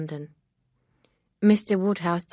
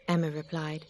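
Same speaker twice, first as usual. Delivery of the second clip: usual speaking voice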